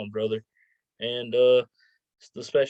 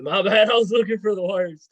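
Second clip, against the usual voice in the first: laughing at himself, louder; embarrassed laugh through the words; laughing apology; 7 semitones higher, 7 dB louder than usual. Real, not acted. Laughs at his own fumble.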